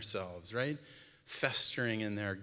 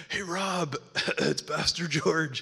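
a coughing, put-on sick caller; faked sick voice; 4 semitones higher, slower than usual. Pastor faking illness on the phone to prank, coughing. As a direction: cough through it like you're ill. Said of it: "they're just pranking me".

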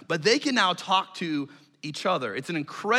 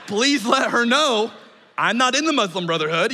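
urgent plea; 5 semitones higher, 5 dB louder than usual. Retold or imitated.

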